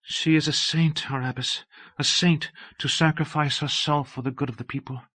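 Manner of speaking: a devout sister murmuring praise; said softly. A narrator's reading. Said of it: "Sister Helvig saying softly".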